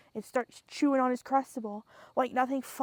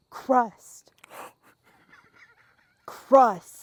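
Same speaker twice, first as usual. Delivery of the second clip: a firm, louder demand, word by word; insistent demand; 9 dB louder than usual. Retold or imitated. Patient demanding his snack, firm and rising in volume. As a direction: keep saying the word louder each time.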